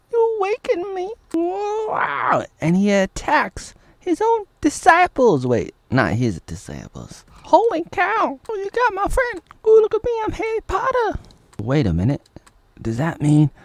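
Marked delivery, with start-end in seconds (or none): 0.1-11.2 falsetto movie character; hammed-up high fake dub; high silly dub voice. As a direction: squeak the lines like a cartoon. Falsetto dubbing.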